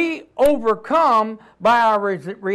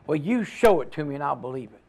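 skeptic quoted; 7 semitones lower, 5 dB quieter, faster than usual. Retold or imitated. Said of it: "I've had people tell me".

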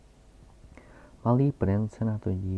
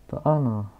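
put-on old geographer; 3 semitones higher, 7 dB louder than usual. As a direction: announce your title with pompous weight. Geographer character voice. (hlasitý, pomalý a důležitý starý zeměpisec)

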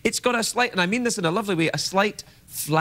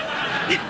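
high breathy laugh; a short laugh. Real, not acted. Host laughs.

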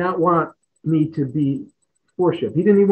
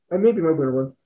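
usual speaking voice

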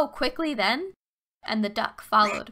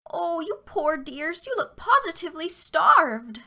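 put-on high, syrupy old lady; sweet old woman voice; 6 semitones higher, 3 dB louder than usual. A narrator's reading. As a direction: coo it like a kindly granny. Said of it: "she exclaimed".

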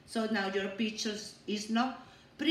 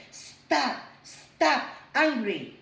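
loud, higher firm stop, stop; 4 semitones higher, 5 dB louder, slower than usual. Shown as a demonstration. Teacher showing class how to stop anger. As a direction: stop the anger like a red light. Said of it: "hold your tummy and say".

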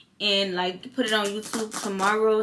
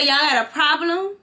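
higher, louder challenge; retold confrontation; 7 semitones higher, 6 dB louder than usual. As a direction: square up to them. Confronting the couple on plane.

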